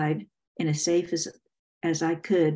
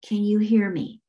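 usual speaking voice

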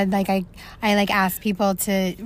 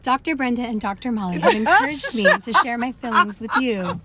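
embarrassed diary reading; 3 semitones higher than usual. Pretend. Mock diary entry voice.

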